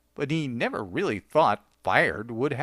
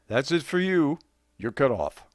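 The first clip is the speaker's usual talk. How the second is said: authoritative. A narrator's reading. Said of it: "the bartender said in authoritative tones".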